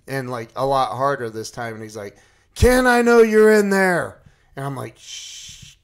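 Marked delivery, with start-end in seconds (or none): 2.5-4.2 retold calling through door. Fink pounds door and calls. 5.0-5.8 soft, long acted shush; hushing shh. Hiding from man at door.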